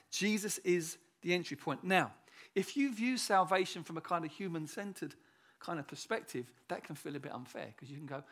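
normal talking voice

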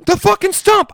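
high, loud exasperated imitation; retold furious yell. Imitating passenger insisting to the driver, exasperated yell. Acted quarrel, passenger's retort.

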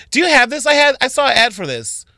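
high-pitched mock customer voice; imitated pestering customer. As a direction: pipe up like a shopper with an ad. Imitates customers asking for advertised items.

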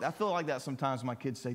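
ordinary talk

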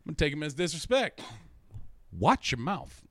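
hammed-up warning to back off; mock confrontational. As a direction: play someone taking it as disrespect. Acting out confrontation over big words.

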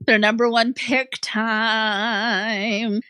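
slow announcer call with the last word drawn out; segment announcement. Pretend. Co-host opening the number one pick round, drawn-out announcing. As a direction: stretch the final word like a jingle.

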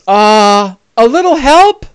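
a woman calling down for help. Acted. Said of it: "waves at you and yells".

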